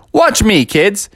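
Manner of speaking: high, punchy call; father showing off. Acted. Father demonstrating to confused kids, mildly hammed-up confident call. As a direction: call for their attention. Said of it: "So he's like".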